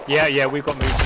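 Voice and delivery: urgent callout. Real, not acted. Spotting enemies, calling out.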